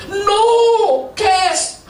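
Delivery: high, curt shopkeeper "no, cash"; shopkeeper refusing. Retold or imitated. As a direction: flatly refuse the card in a high voice. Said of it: "saya keluarkan kartu kredit".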